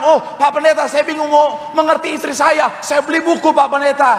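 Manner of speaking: high-pitched, lightly acted complaint; bewildered husband. Confused husband complains to his pastor, high mock-bewildered voice.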